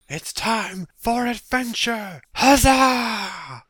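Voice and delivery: high, drawn-out heroic cry; hammed-up hero hollering a loud huzzah; gung-ho adventurer. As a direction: bellow it like a cartoon hero. Mock hero's battle cry for a joke.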